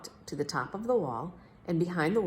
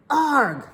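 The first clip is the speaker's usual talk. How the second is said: loud high "Arg!" roar; mock roar; 10 semitones higher, 10 dB louder than usual. Big Max imitating the crocodile's sound, loud roar. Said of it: "They don't say".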